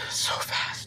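slow hushed sigh of a remark; wistful whisper. Real, not acted. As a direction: murmur it wistfully to yourself. Wistful remark on time passing.

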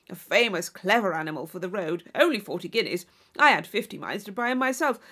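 loud, braggart horse talk; swaggering sportsman showing off; boastful John Thorpe. Acted. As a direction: brag without pausing for her.

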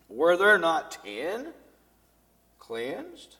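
loud, slow, ringing question; declaimed reading. Shown as a demonstration. Pastor reading Jesus' question to the congregation, declaiming. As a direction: read it out as a bold proclamation. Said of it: "Maybe he said it like I read it".

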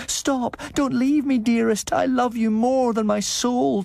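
very high, wailing vampire bride; desperate ghostly cry. A narrator's reading. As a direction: wail after him, pleading. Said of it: "a voice behind me which cried out".

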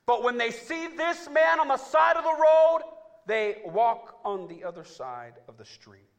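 high, loud, slow preacher's cry; full-force sermon climax; preaching shout. Acted. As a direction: drive the point into the pews.

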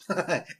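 short, amused man's chuckle; little laugh. A narrator's reading. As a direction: chuckle briefly at the answer. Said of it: "The man laughed a bit".

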